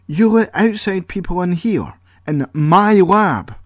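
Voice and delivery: high, loud and slowing outraged voice; Paul raging at Colin over his lab; angry outburst. Acted. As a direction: let the fury spill out word by word. Paul furious about strangers in lab.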